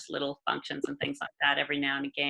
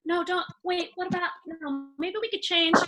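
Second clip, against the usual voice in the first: playful imitation of Garth; 13 semitones higher than usual. Mimicking Garth during home video-making.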